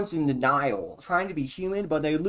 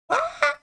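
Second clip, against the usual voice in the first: sudden high yelp. Real, not acted. Cats suddenly in his mind.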